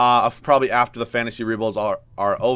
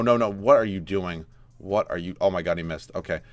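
normal talking voice

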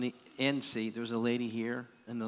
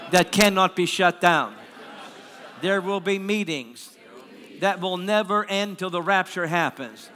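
measured prayer proclamation with long pauses; ringing, deliberate prophetic preaching; preaching declaration; 3 semitones higher, 8 dB louder than usual. Real, not acted. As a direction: declare it over the congregation phrase by phrase.

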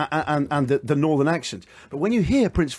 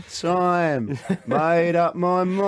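mock Cockney singalong; much slower than usual. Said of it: "just going".

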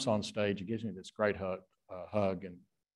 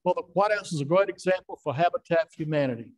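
Carter impression; 8 semitones higher, 6 dB louder than usual. Comedian showing Eddie his Carter, higher-pitched Carter voice. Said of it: "Let me hear your Carter".

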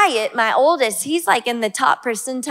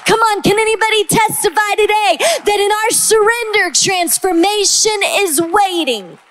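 preaching call; 7 semitones higher than usual. Acted.

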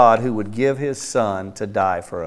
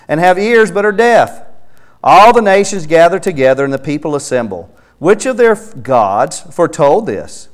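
raised, louder reading of the verse; fervent scripture reading; 6 semitones higher, 6 dB louder than usual. Acted. Preacher reading Isaiah aloud to Alan, fervent and louder. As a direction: proclaim the verse over the listener.

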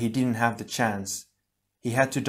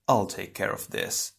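cool composure; 3 semitones lower than usual. A narrator's reading. Calm ice Toa takes over.

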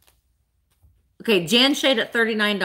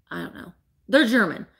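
ordinary delivery

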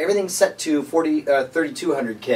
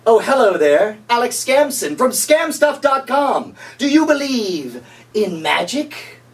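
higher, slow and syrupy sales pitch; cheesy infomercial host; 6 semitones higher, slower than usual. Acted. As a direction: greet the viewer like a late-night TV huckster.

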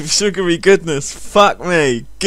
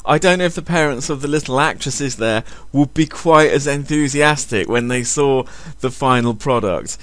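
usual speaking voice

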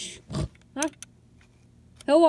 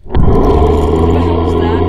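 very deep, loud booming ghost voice; monstrous low ghost tape voice; 12 dB louder than usual. Acted. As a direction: boom it out like a spooky recording. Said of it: "It's a ghost tape".

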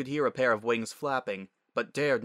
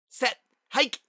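put-on high, sharp young girl's call; short high-pitched play call; child calling the snap; 5 dB louder than usual. A narrator's reading. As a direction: call it out like a kid quarterback.

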